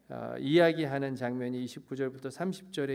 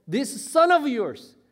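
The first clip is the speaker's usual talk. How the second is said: acted angry accusation, high and loud; furious; 9 semitones higher, 8 dB louder, much slower than usual. Elder son disowns his brother to father, furious and loud. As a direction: spit out the phrase in raised-voice indignation. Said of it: "화가 머리끝까지 난 거예요".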